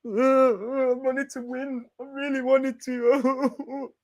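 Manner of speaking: high, slow, whiny sobbing voice; mock crying player. Imitating a crying South Korean player, mock-whining.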